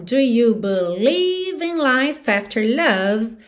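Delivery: "life after" joined, "believe" drawn out; linked "life after". Shown as a demonstration. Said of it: "o F já juntou na vogal A".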